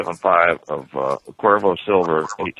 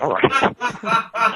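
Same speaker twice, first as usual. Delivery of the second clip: high, light "all right" to the boss; mock agreeable reply. Telling about the boss, an innocent high okay. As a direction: chirp it back innocently.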